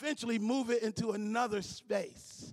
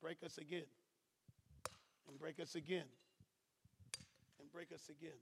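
hushed refrain; 7 semitones lower, 18 dB quieter than usual. Real, not acted. Pastor to church, near-silent repeated plea. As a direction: let it fall almost silent each time.

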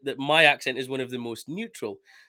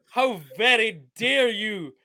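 slow, high, theatrically affronted; hammed-up indignation, pitched high; mock outrage; 7 semitones higher, slower than usual. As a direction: draw it out in pretend offence. Mock offence at Sturgeon comparison.

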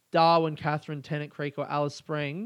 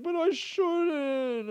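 high, very slow, drawn-out whine; slow whiny protest; 13 semitones higher, much slower than usual. Retold or imitated. Arguing with housemate, slow high whine. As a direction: sulk it out like a kid. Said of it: "And I was like".

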